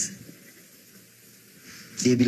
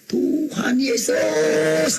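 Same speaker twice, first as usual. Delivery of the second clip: high, long-drawn cry to God; teriakan; 10 semitones higher, much slower than usual. Retold or imitated. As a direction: cry it out high, stretching every syllable. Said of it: "saya teriak". (teriakan tinggi dan panjang)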